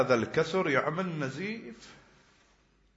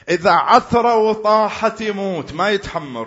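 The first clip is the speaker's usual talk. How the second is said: impassioned cry; 7 semitones higher, 7 dB louder than usual. Real, not acted. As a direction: wail it to the mourners. Preacher cries out.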